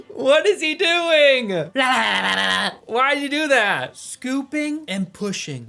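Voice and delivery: gawk at the absurd yoga; incredulous. Real, not acted. Reacting to yoga clip.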